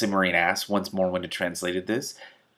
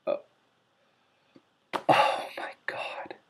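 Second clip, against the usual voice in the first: whispered aside; slower than usual. Real, not acted. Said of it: "reader's own aside".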